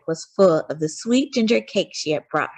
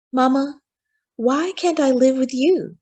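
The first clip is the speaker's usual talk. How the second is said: put-on higher, young boy asking his mother; a small boy pleading to live with Mama; child's voice; 7 semitones higher than usual. A narrator's reading. Little Frederick begging his mama, high child voice.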